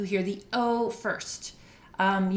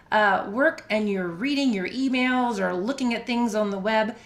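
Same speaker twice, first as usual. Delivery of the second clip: ordinary delivery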